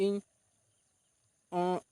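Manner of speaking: demonstrating broken, stop-start reading; halting reading. Shown as a demonstration. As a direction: read word by word, stumbling and restarting with long gaps. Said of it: "ᱱᱚᱝᱠᱟᱢ ᱯᱟᱲᱦᱟᱣ ᱛᱮᱫᱚ ᱵᱷᱟᱹᱭ". (ᱛᱷᱟᱢ ᱛᱷᱟᱢ ᱛᱮ ᱯᱟᱲᱦᱟᱣ)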